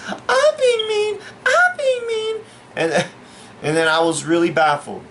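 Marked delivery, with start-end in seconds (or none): 0.1-2.4 very high, drawn-out falsetto retort; squealed mocking echo; mock indignant woman. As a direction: mimic an affronted lady in falsetto. Bar lady's indignant retort.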